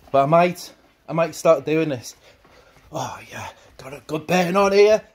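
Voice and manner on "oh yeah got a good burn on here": breathy effort. Real, not acted. Arm swinging while jogging.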